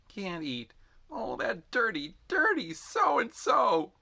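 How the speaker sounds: high, slow, dragged-out cursing; bitter curse. A narrator's reading. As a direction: spit out the insult in exhausted fury. Exhausted Jenkins curses Zim.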